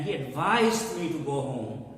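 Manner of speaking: ADVISED stressed as ad-VISED; second-syllable stress. Shown as a demonstration. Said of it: "the stress is on the second syllable".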